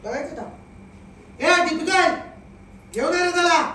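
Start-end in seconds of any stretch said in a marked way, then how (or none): 1.4-3.6 loud call across the room; bossy call to a helper. Acted. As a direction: shout for Deepika to bring him. Calling out to bring him.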